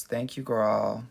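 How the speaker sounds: ordinary talk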